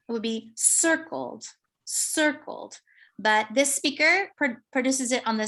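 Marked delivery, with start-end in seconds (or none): 0.5-1.4 "circled" stressed on CIR; first-syllable stress. Shown as a demonstration. Lecturer shows normal stress, "circled" stressed on first syllable. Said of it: "stress is on the first syllable". 1.8-2.8 "circled" with CIR higher and stressed; first-syllable stress. Shown as a demonstration. Lecturer models the normal word, "circled" stressed on first syllable. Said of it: "stress is on the first syllable".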